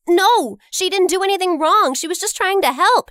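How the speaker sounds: high, loud appeal; pleading protest. Acted. Narrator defends Jenny to the managers, loud and high. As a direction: step in and defend her.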